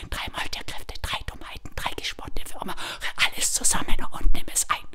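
breathless whisper of the charm, quick; whispered spell. A narrator's reading. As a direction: hiss the spell in a secret whisper. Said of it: "sie murmelte den Zauberspruch".

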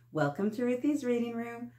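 plain reading voice

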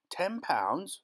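high-pitched, outraged question; incredulous cry. A narrator's reading. Farmer scoffs at the parson's offer, incredulous cry. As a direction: echo it in sharp disbelief. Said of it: "Rummins cried".

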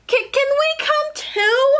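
very high, stammered plea; eager rabbits. Acted. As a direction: ask high and hopeful, repeating the first word. Rabbits beg to join.

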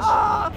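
high panicked shriek; high scared "Ah"; real frightened scream. Real, not acted. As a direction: cry out in fear. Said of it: "for screaming".

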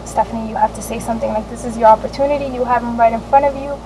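a quick hushed voice in her head; muttered self-encouragement; inner pep talk. Retold or imitated. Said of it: "thinking in my head".

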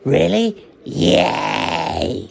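high gasp then cheering yay; excited gasp. Acted. Chipoko thrilled the waiter gives in, gasping and cheering. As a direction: burst with childish joy. Overjoyed at getting meatballs.

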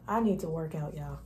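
ordinary delivery

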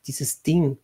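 ordinary delivery

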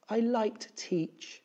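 high, slow, wistful confession; drawn-out, high-pitched sorrow; sighing, upset teacher. A narrator's reading. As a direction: voice a teacher close to tears. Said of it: "Miss Sarves sighed".

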